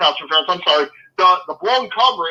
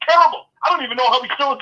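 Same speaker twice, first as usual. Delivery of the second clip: fired up; 5 semitones higher, much faster than usual. Real, not acted. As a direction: blurt it out in disgust. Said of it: "I'm getting really fired up about this".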